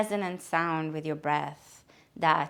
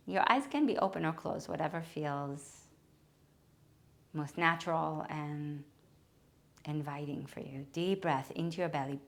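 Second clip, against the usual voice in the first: guided meditation; 2 semitones lower, 4 dB quieter than usual. Acted. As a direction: ease them into the breath, softly and unhurried. Guided breathing meditation, soft.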